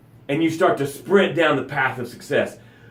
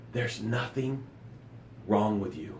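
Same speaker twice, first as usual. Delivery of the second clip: much softer, lower, gently reassuring; soft reassurance; 7 semitones lower, 14 dB quieter than usual. Real, not acted. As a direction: lay it down softly and low.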